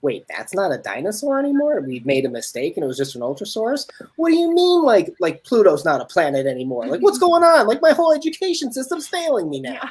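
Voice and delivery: high, loud exasperated disbelief; mock outrage. Kid whose childhood was lied to, mock outraged. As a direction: rant like a betrayed dino fan. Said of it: "and now it's like".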